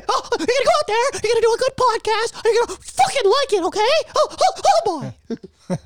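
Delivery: very high, fast cartoon mouse threat; bully them in a chirpy cartoon squeak; Mickey Mouse voice. Mickey Mouse bullying the hosts into a podcast, squeaky. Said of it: "Mickey Mouse".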